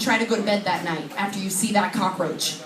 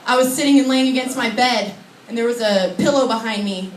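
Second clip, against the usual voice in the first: ordinary delivery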